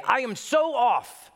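high, slow mock cry of being lost; a hint of a lost sinner; mock lost soul. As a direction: whine it like someone far off track.

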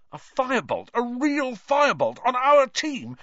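a captain who can't contain his joy; thrilled. A narrator's reading. Wood thrilled about the broom.

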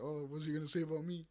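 usual speaking voice

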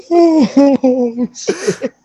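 laughing it off after a fright; breathless relieved laugh; relieved laughter. Real, not acted. Laughing after the explosion scare.

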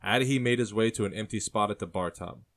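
ordinary delivery, nothing marked

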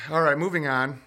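ordinary delivery

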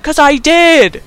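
high, loud, drawn-out retort; mock indignant protest. [name] insisting to Gordon he was shocked, mock indignant. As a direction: protest it slowly in mock outrage.

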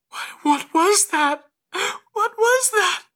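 very high, loud, horrified cry; wide-eyed shock. Acted. Mother stepping back from a vision, horrified. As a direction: recoil in panic, voice shooting up. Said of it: "steps back wide-eyed".

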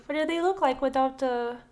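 ordinary delivery

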